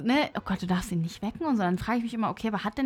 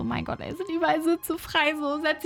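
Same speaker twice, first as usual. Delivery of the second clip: raised pitch, annoyed thought; exasperated inner voice; 6 semitones higher than usual. Retold or imitated. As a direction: grumble it in your head. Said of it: "ich dachte mir so".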